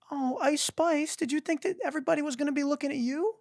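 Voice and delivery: very high sing-song teasing, fast at the end; mocking taunt. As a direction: gloat at her like a smug kid.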